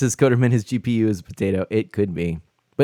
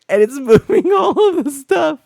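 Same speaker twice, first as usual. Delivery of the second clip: laughing; 6 dB louder than usual. Real, not acted. Cursor moving by itself.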